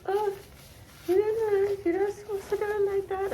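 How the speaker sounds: high, loud whiny nitpicker; squeaky know-it-all mockery; mocking commenter voice.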